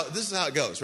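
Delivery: usual speaking voice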